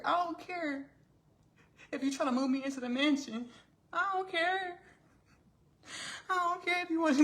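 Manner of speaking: building to a very high-pitched squeal; mock defiance. Elizabeth refuses mansion and horse, mock defiant squeal.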